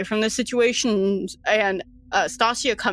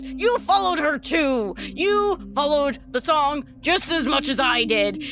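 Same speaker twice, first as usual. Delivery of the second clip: higher, outraged comeback; indignant retort; 5 semitones higher than usual. Acted. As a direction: throw the blame right back at him.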